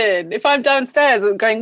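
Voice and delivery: ordinary talk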